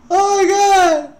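loud high gasp into a slow oh my God; gasp, then drag it out in disbelief; shocked gasp. Real, not acted. Streamer stunned by a brutal track, loud gasping disbelief. Reacting to harsh song.

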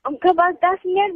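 usual speaking voice

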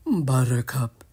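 breathy sigh of a name; sighed whisper. Acted. Mike the cow longing for Buttercup, whispered sigh. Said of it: "Mike sighed".

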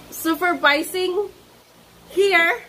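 teasing echo. Real, not acted. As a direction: tease him by throwing his word back.

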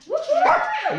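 high, loud cheer; excited cheer. Real, not acted. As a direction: whoop with joy. Cheering the score.

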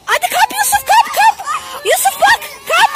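high-pitched coaxing calls; repeated high, eager commands; urgent urging. Real, not acted. Urging child to grab in game.

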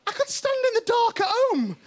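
sarcastic, pitched well up; mock sarcasm. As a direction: scoff at the dull ride, voice high. Mocking the dark attraction.

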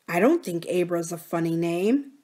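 ordinary delivery, nothing marked

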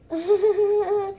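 giggle. Pretend. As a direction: laugh at the silly puppy. Laughing at silly puppy.

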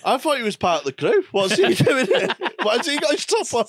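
laughing disbelief. Real, not acted. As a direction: squeal it out through laughter. Mock outrage, laughing.

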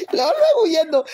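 laughing. Real, not acted. Laughing as husband runs.

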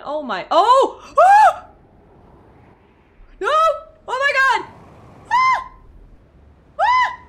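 very high, loud shrieks; panicked screaming. Real, not acted. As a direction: scream helplessly as you plummet.

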